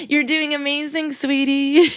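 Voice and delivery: lightly voiced Kris Jenner, sweet and cooing; Kris Jenner impression. Host imitating a reality star praising her daughter, mildly sweet. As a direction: coo it like a proud celebrity mom.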